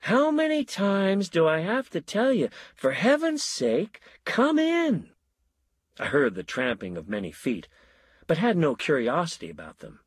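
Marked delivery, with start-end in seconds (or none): 0.0-5.1 high, pleading exasperation; high exasperated plea; exasperated call. A narrator's reading. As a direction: throw up your hands and call them in. Exasperated host calling out.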